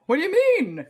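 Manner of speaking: high, loud shocked question; shocked disbelief. Retold or imitated. Patient hit by doctor's verdict, high and shocked. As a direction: leap up in pitch, stunned. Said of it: "And I'm like".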